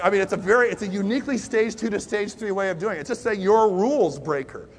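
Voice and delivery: ordinary talk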